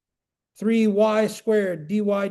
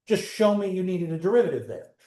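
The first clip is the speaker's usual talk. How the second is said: usual speaking voice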